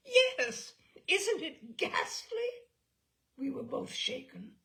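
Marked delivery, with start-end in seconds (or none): 0.1-2.5 high, loud, half-laughing yes, then slow ghastly; gleefully appalled, to the best friend; telling the friend, a giggling shocked teenager; laughing horror. Retold or imitated. Girls gasp over scandal.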